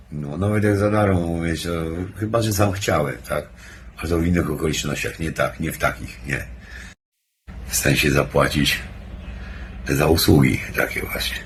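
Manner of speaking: ordinary talk, nothing marked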